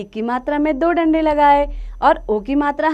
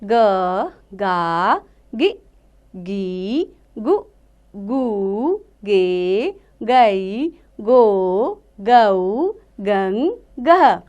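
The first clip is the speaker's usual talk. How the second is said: put-on teacher leading children in reciting ख, खा, खी; syllable drill; 2 semitones lower, much slower than usual. Shown as a demonstration. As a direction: clear chanting of each syllable for children to repeat. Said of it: "आप भी मेरे साथ साथ बोलिए".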